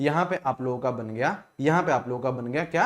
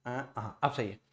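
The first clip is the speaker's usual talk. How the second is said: relieved mutter; 4 semitones lower, 10 dB quieter than usual. Real, not acted. Glitch fixed, relieved aside.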